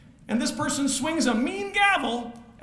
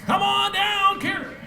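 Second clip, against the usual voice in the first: game-show call; 8 semitones higher than usual. Real, not acted. Host calls the winner up.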